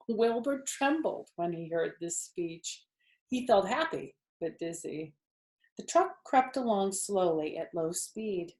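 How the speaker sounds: ordinary delivery, nothing marked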